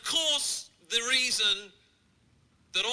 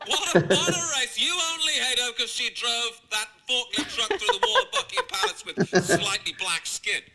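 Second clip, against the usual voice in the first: louder, higher, laughing fake outrage; mock outraged yelling; 4 semitones higher, 4 dB louder than usual. Comedian mocking TV guest, in hammed-up outraged yelling. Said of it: "this lady would be on T V going".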